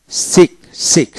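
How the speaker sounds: "সিক" ending in one k sound; silent c. Shown as a demonstration. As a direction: model "সিক" with no separate c sound. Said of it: "সিক মানে সি উচ্চারিত হবে না".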